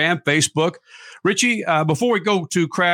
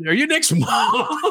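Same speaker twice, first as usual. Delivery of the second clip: laughing question; 10 semitones higher, slower than usual. Retold or imitated. Asking mom while laughing.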